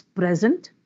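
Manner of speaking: "present" as a noun, PRES stressed; stress on first syllable. Shown as a demonstration. Teacher giving noun examples, first-syllable stress. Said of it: "First syllable".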